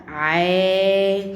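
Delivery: "ع" held as a long, loud aa; elongated ayn. Shown as a demonstration. Teacher answers with the madd sound, loudly holding "ع" as aa. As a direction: stretch "ع" out on a steady vowel. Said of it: "والعين هنا ممدود".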